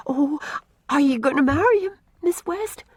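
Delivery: hopeful little rush; eager. A narrator's reading. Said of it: "asked Una eagerly".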